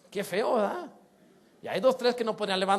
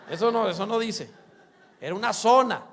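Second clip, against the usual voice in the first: normal talking voice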